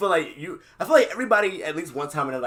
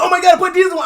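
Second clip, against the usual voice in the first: high, loud horrified cry, acted out; mock panic; 13 semitones higher, 12 dB louder, faster than usual. Driver realizing he pumped diesel, mock panic. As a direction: imitate a driver in alarm, pitched way up. Retold driver discovers wrong fuel.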